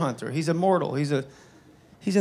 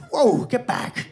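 mock alarm; 3 semitones higher than usual. Onlookers warn each other off the tailed man, mock alarm. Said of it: "They'd say".

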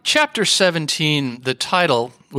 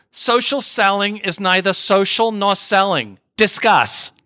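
higher, mock New York accent; Linda Richman; 5 semitones higher than usual. Guest parodies chapter seventeen's title, in a mock New York accent. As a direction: parody a New York talk show host. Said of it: "I'm gonna do my best, uh, Linda Richman, uh, impersonation".

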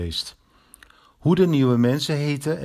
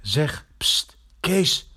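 hushed call; 4 semitones higher, much slower than usual. Acted. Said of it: "hoorde hij Eddy roepen".

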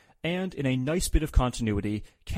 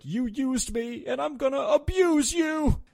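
high, slow growing wrestler yell; imitating Shamrock; 14 semitones higher, slower than usual. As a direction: build from slow to a shout. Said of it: "where he was like".

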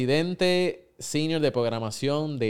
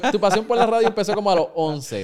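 laughing; 8 semitones higher, 5 dB louder than usual. Real, not acted. Host teases guest with research.

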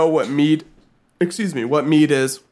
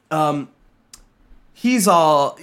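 ordinary delivery

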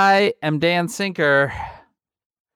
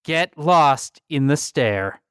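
dramatic ad read. Acted. Book ad read.

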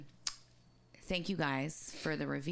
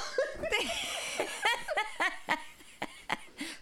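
high laugh. Real, not acted. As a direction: howl with laughter. Cracking up at own rhyme.

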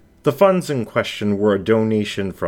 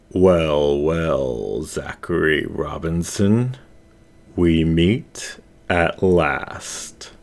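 menacing shadow voice; 2 semitones lower, much slower than usual. Acted. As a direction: savour each word like a hunter cornering prey. Shadow voice confronts Zack.

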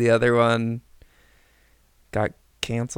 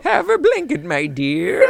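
high, loud caricature of a maid; hammed-up, high-pitched maid; mock doting maid; 12 semitones higher, 5 dB louder than usual. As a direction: fuss in a squeaky put-on maid voice. Acts out the maid.

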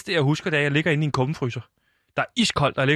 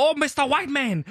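imitated crowd cheer; 10 semitones higher than usual. Storyteller mimics crowd hailing him, high mock shout. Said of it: "der er nogen, der råber".

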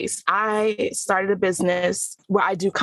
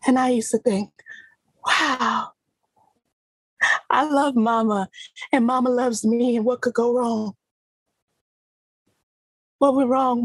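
heightened, pitched-up acting voice that swells and breaks; stage monologue, raw and emotional; dramatic monologue; 6 semitones higher than usual. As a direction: play a hurt daughter talking to Mama. Performed acting monologue on mic.

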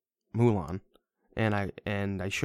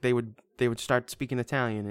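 ordinary delivery

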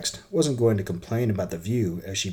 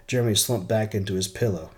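ordinary delivery

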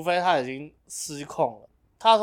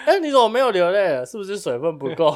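high, loud question through laughter; laughing mock concern; 6 semitones higher, 6 dB louder than usual. Teasing a pretend crier about water, laughing mock concern. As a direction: pretend to check on them, cracking up.

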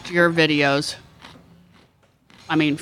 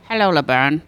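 Squiggy; 4 semitones lower than usual. Acted. Host offering the only impression he does, low sitcom greeting. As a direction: lower sitcom sidekick greeting. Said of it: "Squiggy and say".

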